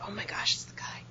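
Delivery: hushed, unvoiced whisper; whispered dread. Clerk recognises the caller, whispered dread. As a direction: whisper it as a stunned aside.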